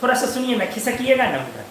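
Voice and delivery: soft, coaxing plea to move over; sweetly coaxing. Pretend. Student coaxing a seated classmate, sweet and soft. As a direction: ask the bench-mate nicely, gently. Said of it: "आराम से बोलते हो".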